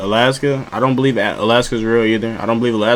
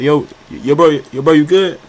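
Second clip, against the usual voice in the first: higher, louder, rising at the end; calling out; 4 semitones higher, 4 dB louder than usual. Acted. Hunter calls toward a snapped twig, calling out. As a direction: call out with rising urgency to check on someone. Acts out calling to approaching noise.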